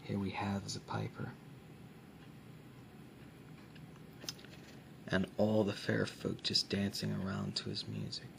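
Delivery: hushed, close soft-spoken ASMR. Acted. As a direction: speak softly, as if close to the mic. ASMR book showing, soft spoken.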